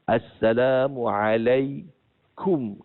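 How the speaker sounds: Assalamualaikum recited slowly, closing on kum; slow recited salam ending in kum; correct kum ending. Shown as a demonstration. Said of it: "K U M".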